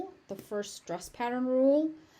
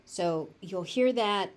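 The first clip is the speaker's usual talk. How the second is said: ordinary talk, nothing marked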